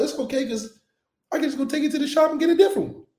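high-pitched, carefree brush-off; breezy excuse. Acted. Player excusing a wrecked borrowed car, breezy.

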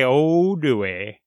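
ordinary talk

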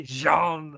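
drawn-out French "Jean", zhahn; French pronunciation. Shown as a demonstration. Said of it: "That's what I call him".

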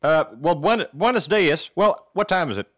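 put-on Southern accent. Panel speaker greeting the audience, Southern accent. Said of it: "let's do your Southern accent".

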